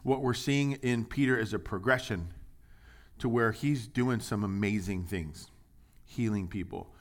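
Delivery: ordinary talk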